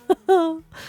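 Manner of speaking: high, short laugh; real high giggle; amused laughter. Real, not acted. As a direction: let a laugh burst out. Host laughs at the horror.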